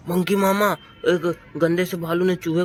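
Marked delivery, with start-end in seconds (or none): 0.1-0.8 high, loud shout of mama; loud call. Pretend. Child yells for monkey uncle to come, loud shout. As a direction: yell for Monkey Mama.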